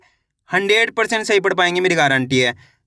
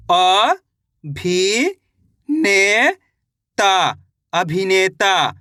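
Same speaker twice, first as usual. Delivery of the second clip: अ, भी, ने, ता said one by one, then joined as अभिनेता; slow teacher spelling; sounding out syllables; much slower than usual. Shown as a demonstration. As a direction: spell it out for a child. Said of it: "मैं किस तरीके से एक एक अक्षर का उच्चारण करके".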